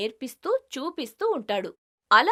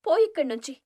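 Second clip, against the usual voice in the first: angry dismissal; 6 semitones higher, 3 dB louder, faster than usual. Acted. Rupavathi rejects him angrily.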